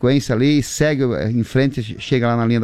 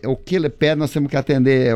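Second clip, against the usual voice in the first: ordinary delivery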